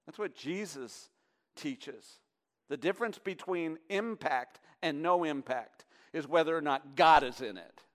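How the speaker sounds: normal talking voice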